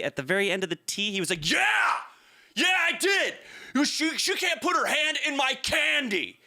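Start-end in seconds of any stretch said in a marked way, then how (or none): 1.5-6.4 high-pitched mock outrage; imitated angry yelling. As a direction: mimic an indignant man yelling back. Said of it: "yelling at me".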